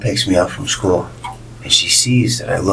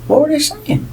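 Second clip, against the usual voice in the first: high, worried mom asking after her son; mom's voice. Retold or imitated. Mom picking up her upset son, high and concerned. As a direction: play the fussing mother, well up high.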